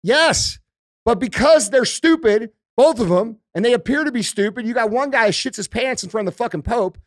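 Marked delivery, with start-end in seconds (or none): none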